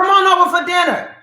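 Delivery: breezy fake-friendly invite; insincere invitation. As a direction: invite them without meaning it. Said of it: "Well, I didn't mean it".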